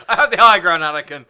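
Obi-Wan quote. Retold or imitated. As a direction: declaim it like the Jedi standing over his fallen student. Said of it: "We're talking about Star Wars now".

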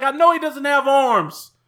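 high, slow hammed-up cop; mock indignant cop. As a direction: ham up the cop's excuse. Imitating cop's retort.

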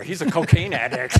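loud, laughing finger-pointing; laughing mock accusation. Pretend alcoholic points at Bart in an AA meeting, laughing mock accusation. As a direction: break into laughter while shifting blame.

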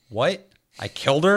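rising, high mock shock; retold disbelief. Player learning he killed the captive, high mock disbelief. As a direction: act stunned at the news. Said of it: "I'm like".

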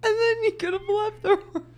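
high laughing voice. Real, not acted. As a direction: talk through the giggles. Still laughing hysterically.

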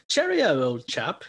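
an American's hammed-up English farewell; mock English accent. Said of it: "like when Americans will say".

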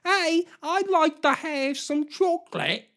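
high, young mock British voice. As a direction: play a youthful Brit asking sweetly for chocolate. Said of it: "They go from sounding".

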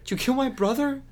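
very high, loud parody of a bad actor; badly acted outraged question; mock accusation. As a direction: squeak out the accusation, hammed up. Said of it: "and he's like".

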